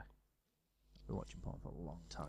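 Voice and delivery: slow, hushed whisper, almost under the breath; whispered confession. Real, not acted. Sheepish joking confession.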